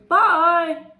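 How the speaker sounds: high, loud call; departing bus driver. Acted. Bus driver drives away, high loud goodbye. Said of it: "drives away and he says".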